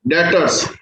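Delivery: डेटर्स said as "detters", no b sound; silent b pronunciation. Shown as a demonstration. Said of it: "इसमें बेटे बी silent रहता है". (बी silent, डेटर्स)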